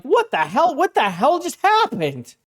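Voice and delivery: hammed-up shock at losing everything; mock disbelief. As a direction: play a stunned player after the wipe. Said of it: "you're like".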